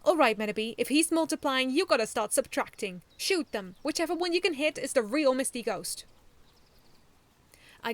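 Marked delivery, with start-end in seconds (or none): 0.0-6.0 loud, higher battle orders; eager command. A narrator's reading. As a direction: direct your robot keenly. Child commanding his robot.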